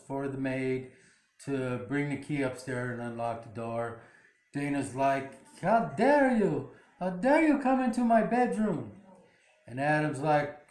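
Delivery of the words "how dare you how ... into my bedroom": high, retold outrage; indignant imitation with a high, sweeping tune; mock indignation. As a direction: act out an affronted protest, pitch raised. Acted quarrel, daughter outraged at intrusion.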